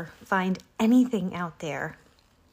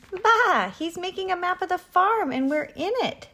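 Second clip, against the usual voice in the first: high, loud bleating boast; excited sheep voice, very high; sheep's cry; 12 semitones higher, 5 dB louder than usual. A narrator's reading. Sheep butt in to tell Abigail the news, crying out. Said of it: "the sheep cried".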